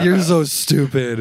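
laughing imitation of a fond insult; amused teasing. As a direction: draw it out, laughing. Wife's amused retort, retold amid laughter.

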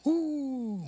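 high, soft, drawn-out ooh; long falling ooh of mock wonder; awed "ooh". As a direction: coo as if dazzled. Acts longing for the quick fix.

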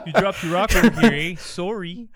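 loud, laughing, high mock Canadian accent. Friends riffing on Canadian politeness, loud mock Canadian accent. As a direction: ham up a polite Canadian, ending on sorry. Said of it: "I can't Canadian accent".

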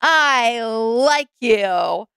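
slow, drawn-out mock confession; awkward confession. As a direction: spell it out very slowly, like an awkward declaration into a microphone. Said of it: "stand up at a podium in an empty room and go".

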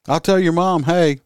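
loud, high joking holler; mock yell back. Officer hollering a comeback at the heckler, loud mock yell. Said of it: "yelled back at him".

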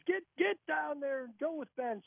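high-pitched, half-hearted coach barking an order; a light imitation of a coach sending him in; teasing impression of the line coach; mock coach order. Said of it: "And Russ goes".

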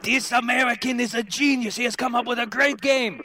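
loud, high-pitched attempt at a German accent; excited-sounding mock German accent. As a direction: put on a hammed-up German accent, pitched well up. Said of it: "my attempt in a German accent".

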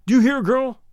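angry demand. A narrator's reading. Jealous rival ordering the woman to listen, angry demand. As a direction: demand obedience with force.

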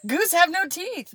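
high, loud, slow insistence; mock exasperated boyfriend; imitating boyfriend. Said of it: "he kept saying".

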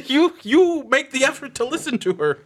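high-pitched, exasperated defence; indignant objection. Real, not acted. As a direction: jump in to stand up for her. Indignantly defends the actress's accent.

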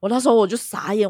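usual speaking voice

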